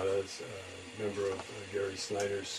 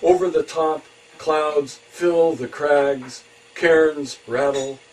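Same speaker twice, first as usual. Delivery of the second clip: high, loud, slow phrases with pauses; poetry reading; 7 semitones higher, 11 dB louder, slower than usual. Acted. Poet reading a travel poem to listeners, measured poetry reading. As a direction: declaim the poem image by image.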